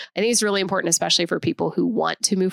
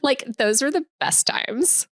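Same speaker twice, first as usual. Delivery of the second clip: put-on breathy Valley Girl whisper on "Like, those were the best times"; drawn out, hushed, dreamy valley girl; 6 semitones higher, slower than usual. Nostalgic friend hushes a Valley Girl line.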